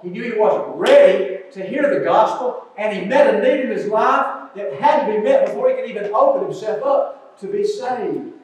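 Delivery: preacher building toward the gospel; rising preaching. Acted. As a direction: drive the point home.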